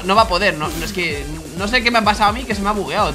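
ordinary talk

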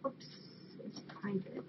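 under the breath; hushed aside. Real, not acted. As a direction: drop to a whisper, as an aside. Reacts to a private card.

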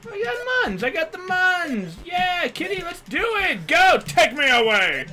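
high, gleeful cheering; excited high squeal. Real, not acted. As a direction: squeal it out with joy. Cheering on jumping cat.

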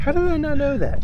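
high, squeaky disbelieving question; can't believe they missed it; stunned disbelief. Real, not acted. Stunned disbelief at game mechanic.

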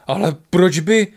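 loud, drawn-out protest; indignant outburst. A narrator's reading. Lišák protests about the burning library, indignant outburst. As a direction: burst in, stung by the injustice. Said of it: "utrhl se za nespravedlnost Lišák".

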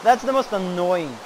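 loud, sharp command; shout. Real, not acted. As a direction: yell at the noise to stop. Shouting at an annoying sound.

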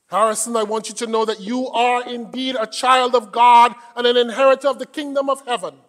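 loud, emphatic pulpit address; preaching. Real, not acted. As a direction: proclaim it loudly to the congregation. Preaches at full force.

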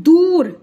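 दूर with the "oo" held long; stressed long ऊ. Shown as a demonstration. Teacher asking learners to hear the ऊ in दूर, vowel drawn out. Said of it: "ऊ का sound किस व्यंजन से आप आ रहा".

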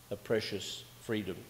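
normal talking voice

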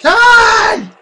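frustrated shout. Real, not acted. Gamer yelling at an unfair level, loud frustrated cry.

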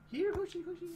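very high coaxing call; calling horse. Real, not acted. Player calling a horse, high coaxing call. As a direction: coax the horse over.

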